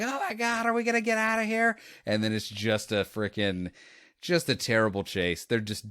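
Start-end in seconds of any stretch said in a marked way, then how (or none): 0.0-1.8 high, fast imitation of panicking passengers; hammed-up squeal of alarm, words racing; mock panic. Passengers react to driver passed out mid-chase, mock panic.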